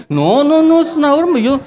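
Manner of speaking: high, quick, protesting backpedal; husband to wife, a high, flustered denial. Retold or imitated. Defending himself to his wife.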